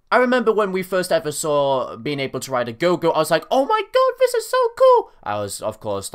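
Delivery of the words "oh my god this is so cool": squeaky high excited voice; way up high, thrilled; high-pitched. Retold or imitated. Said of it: "like, high-pitched back then".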